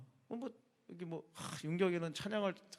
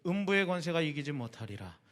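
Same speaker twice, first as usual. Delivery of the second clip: low, measured Bible reading voice; scripture reading; 5 semitones lower than usual. Acted. Pastor reading scripture to the youth group, solemn and measured.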